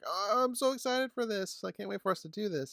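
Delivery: high, excited mimicry of a co-host; Spring, excited. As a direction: imitate an eager fan, high and quickening. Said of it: "Spring was like".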